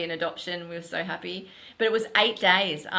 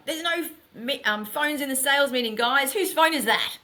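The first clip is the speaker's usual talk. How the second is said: mock stern boss; 8 semitones higher, 4 dB louder than usual. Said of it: "I was a bit like".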